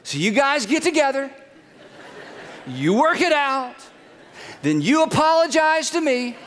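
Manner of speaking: hammed-up self-righteous husband; mock indignant Adam. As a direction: play the offended victim for laughs. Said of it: "In other words".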